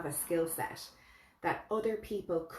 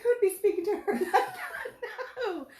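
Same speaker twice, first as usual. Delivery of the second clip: high, laughing outraged question; mock indignant squeal with a laugh; shocked disbelief; 6 dB louder than usual. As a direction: sound scandalised through a laugh. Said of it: "I was like".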